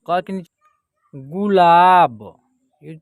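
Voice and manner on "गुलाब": गुलाब loud and slow, long ला; drawn-out model reading. Shown as a demonstration. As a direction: hold the आ in गुलाब. Teacher models the word.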